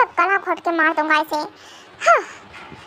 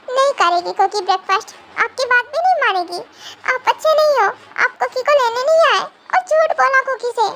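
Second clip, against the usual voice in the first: put-on higher babyish stubborn voice; a sulking little girl refusing; childish sulk; 4 semitones higher than usual. Cookie sulking. (रूठी बच्ची जैसी आवाज़)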